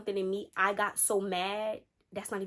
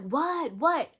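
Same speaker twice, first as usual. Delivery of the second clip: high, exasperated retort; acted annoyed answer; 6 semitones higher than usual. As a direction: pick up the phone as an irritated man, pitch up. Acting man snapping on phone.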